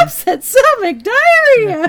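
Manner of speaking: high, slow ad jingle sing-song. Retold or imitated. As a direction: belt it like the old antacid ad. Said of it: "Pepto-Bismol commercial".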